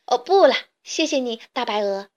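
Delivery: ordinary delivery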